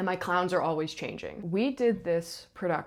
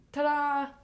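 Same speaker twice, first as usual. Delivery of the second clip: high, bright flourish for the finish; mock showbiz ta-da; 9 semitones higher than usual. Stage manager retelling the clowns' finale, a playful ta-da. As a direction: sell the ending of the dance.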